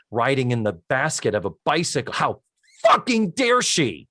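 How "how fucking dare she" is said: higher, slow, indignant mock huff; mock outrage. Mocking Andy's overreaction, hammed-up indignation. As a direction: play up fake offence at a harmless joke.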